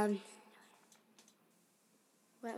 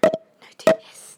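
loud; 15 dB louder than usual. Real, not acted. Urging friend to hit mic.

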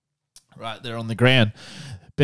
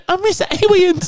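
mock baby whine. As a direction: whimper it like a baby. Mock whiny son.

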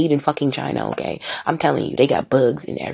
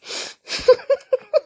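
squealing high-pitched giggle. Real, not acted. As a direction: break into a helpless high laugh. Cracking himself up while high.

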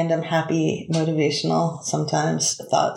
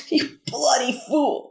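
slow, higher squawky duck voice; talking duck voice; 6 semitones higher than usual. Retold or imitated. Imitating the cursing duck to the audience, slow and high. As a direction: play the parrot-like duck, drawing it out. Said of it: "acting like a parrot, but it's saying".

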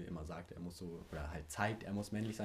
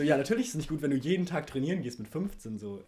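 emphatic retort; 6 semitones higher, 8 dB louder than usual. Retold or imitated. Own retort in story.

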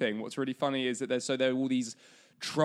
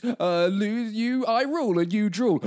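put-on higher, louder childish taunt; dopey cartoon bellow; 8 semitones higher, 6 dB louder than usual. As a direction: bellow like a silly cartoon troll. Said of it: "They're like".

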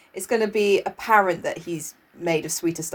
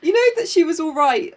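normal talking voice

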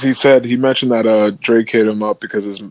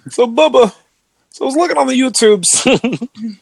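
comic put-on voice; 13 semitones higher than usual.

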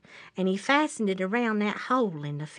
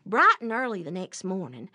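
ordinary delivery, nothing marked

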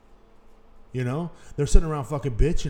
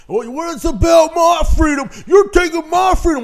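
mock customer shout; 11 dB louder than usual. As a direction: shriek it like an outraged customer, loud and high. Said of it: "people would act like they're oppressed".

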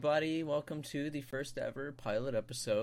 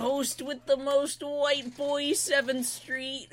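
high, hyped host intro; big showman welcome; announcer voice; 13 semitones higher, 3 dB louder than usual. Acted.

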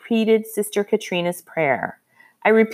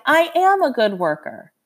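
insistent cry; 8 semitones higher than usual. A narrator's reading. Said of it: "I cried".

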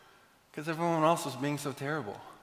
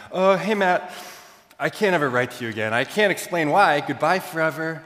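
panicked email; 6 semitones higher, 7 dB louder than usual. Retold or imitated. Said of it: "I write a panicked email".

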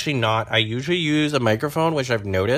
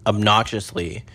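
normal talking voice

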